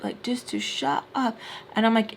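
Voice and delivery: normal talking voice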